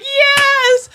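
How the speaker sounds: high, drawn-out triumphant yes; mock crowd cheer. Onlookers backing the mask wearer, mock cheer. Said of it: "Everyone around me is going like".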